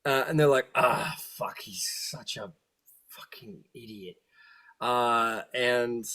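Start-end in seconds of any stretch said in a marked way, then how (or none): 0.7-4.1 softer hushed devil sneer; contemptuous whisper; whispered scorn. Acted. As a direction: whisper it behind his back. Devils mutter about him.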